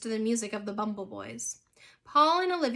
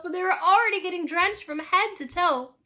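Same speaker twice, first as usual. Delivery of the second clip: ordinary delivery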